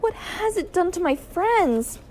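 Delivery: high, slow wail; drawn-out, anguished question; dismayed cry. A narrator's reading. Girl fearing for her friends, high dismayed cry. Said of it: "I cried".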